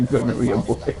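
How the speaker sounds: chuckling through the words; giggling at the silly name; laughing. Real, not acted. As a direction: laugh it out, amused. Laughing at a video's story.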